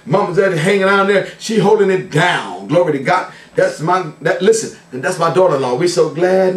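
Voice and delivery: usual speaking voice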